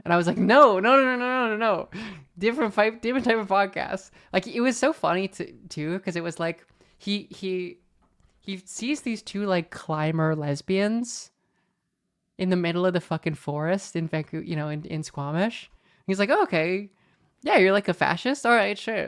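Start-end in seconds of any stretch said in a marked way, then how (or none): 0.4-4.1 loud, high rush of repeated protest; emphatic denial. Retold or imitated. Podcaster to the man who thought they hosted Brand, emphatic denial. 16.3-19.0 puzzled acceptance. Retold or imitated. Voicing man's puzzled reaction to them.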